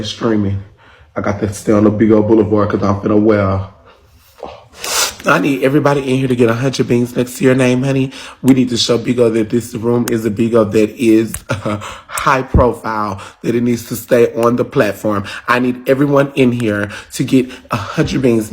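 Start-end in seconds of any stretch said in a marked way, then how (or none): none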